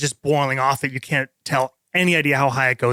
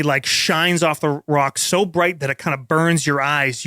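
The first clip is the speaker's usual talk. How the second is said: normal talking voice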